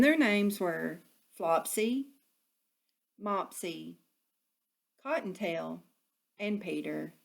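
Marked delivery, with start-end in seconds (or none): none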